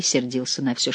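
plain reading voice

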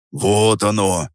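usual speaking voice

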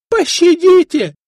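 high, loud, desperate cry; desperate plea. Acted. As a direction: beg for your life. Tortured usurer begs for mercy. (отчаянная мольба)

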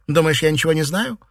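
high, fast, loud scolding; angered. A narrator's reading. Mistress berating a servant who hid the truth, angered. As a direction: scold in a rush, then ease into bitter sarcasm. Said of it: "рассердилась Фэнзе".

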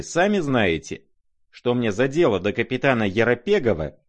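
high, loud, aggrieved complaint; mocking insistence. A narrator's reading. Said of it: "тем же насмешливым тоном".

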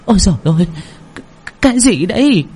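put-on high startled old woman's cry; old mother crying out. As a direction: yelp it in shock as an old woman. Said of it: "thì hô lên". (bà cụ hốt hoảng kêu lên, giọng cao)

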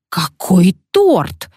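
slow, loud outraged cry; indignant exclamation. A narrator's reading. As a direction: bristle at the very idea. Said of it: "возмущённо воскликнула".

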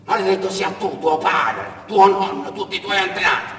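high, loud cursing cry; a parched traveller screaming curses at the road; shouted curse. Acted. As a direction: curse them all at the top of the voice. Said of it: "cominciò a gridare".

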